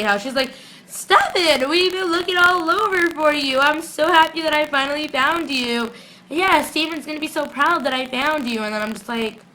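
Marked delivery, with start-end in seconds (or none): 0.9-8.6 high-pitched, over-excited imitation; mock gushing. Vlogger imitating someone who finally found you, mock gushing. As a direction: gush it hammed-up, way up high. Acts out gushing reunion after searching.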